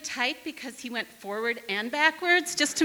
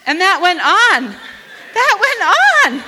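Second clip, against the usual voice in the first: high, loud laughing retelling; 10 semitones higher, 11 dB louder than usual. Real, not acted. Storyteller to audience, laughing at the memory. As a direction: laugh it out as you tell it.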